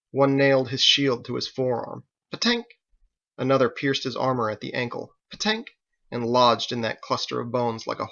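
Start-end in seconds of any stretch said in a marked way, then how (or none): 2.3-2.6 sharp high bolt-strike sound effect; metallic impact noise. A narrator's reading. Bolt hitting armor. 5.3-5.7 sharp high bolt-strike sound effect; metallic impact noise. A narrator's reading. Second bolt hits ankle.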